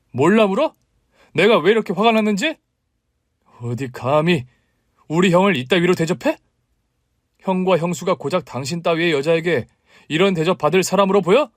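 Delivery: high, loud enraged rebuke; furious man shouting at his wife. Acted. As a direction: tear into her with fury. Brother-in-law furious at wife.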